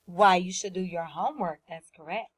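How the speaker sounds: ordinary talk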